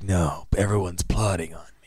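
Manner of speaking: whispered, paranoid. Retold or imitated. Flock suspects everyone, paranoid whisper. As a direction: hiss it like he's being watched. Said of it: "He's like".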